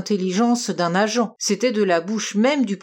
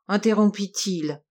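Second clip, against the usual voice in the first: ordinary delivery, nothing marked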